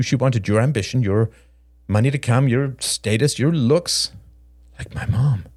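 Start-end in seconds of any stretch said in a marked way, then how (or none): none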